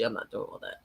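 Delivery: dropped to a low mutter; muttered under breath. Shown as a demonstration. Muttering to the viewers that he won't watch the debate. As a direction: say it to yourself, dismissive.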